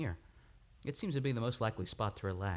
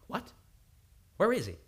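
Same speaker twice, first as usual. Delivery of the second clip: sharp, louder urgent question; urgent question on the phone; alarmed; 9 semitones higher, 5 dB louder than usual. Acted. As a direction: demand it in alarm.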